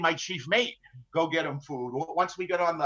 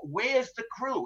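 slow, higher insistent pirate question; pirates demanding; 7 semitones higher, 3 dB quieter, slower than usual. Retold or imitated. Pirates pressing the captain for his crew, slow and high. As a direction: drag it out, pressing him for an answer. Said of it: "that's what they constantly queried me".

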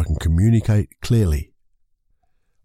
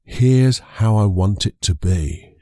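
slow dictation; much slower than usual. Shown as a demonstration. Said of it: "a little bit of a structure".

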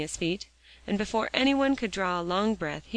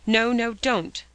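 sharp, raised-voice protest; urgent, sharp refusal; sharp cry; 4 semitones higher, 6 dB louder, slower than usual. A narrator's reading. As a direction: forbid it sharply, higher and louder. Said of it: "cried Polly sharply".

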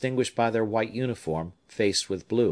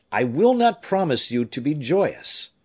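higher, louder opening, mildly emphatic; a touch of youthful protest, pitched up; lightly voiced earnest young man; 6 semitones higher, 5 dB louder than usual. Young man answering his father's farewell, lightly voiced earnest young man. Young man's reply in story narration.

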